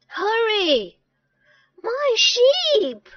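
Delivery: high cry of alarm; urgent shout. Acted. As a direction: urge them on in feigned panic. Sam urges villagers uphill.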